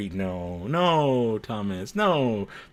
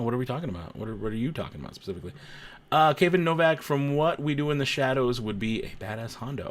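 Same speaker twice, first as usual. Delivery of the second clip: usual speaking voice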